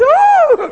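very high, loud imitated scream; piercing mock shriek; startled shriek. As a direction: mimic a woman's squeal of surprise. Acts out the woman's startled reaction.

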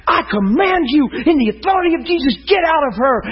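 high-pitched imitated exorcism command; mock stern command. Preacher imitating pregnant woman rebuking demon, mock commanding.

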